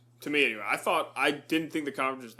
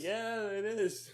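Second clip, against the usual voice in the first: slow mock crowd; 9 semitones higher, 4 dB quieter, much slower than usual. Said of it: "And everyone's like".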